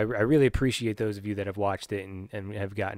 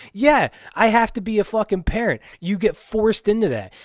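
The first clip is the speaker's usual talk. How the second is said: higher and louder, fed up; loud exasperated rant; 9 semitones higher, 6 dB louder than usual. Real, not acted. Vlogger fed up with parenting advice, loud and exasperated. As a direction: blurt it out in frustration. Angry rant about being forced.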